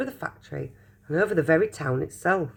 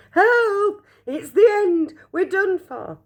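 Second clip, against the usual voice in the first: much higher, louder cry for help; terrified shout; 4 dB louder, slower than usual. Acted. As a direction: scream that it's the end. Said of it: "Grandpa Joe shouted".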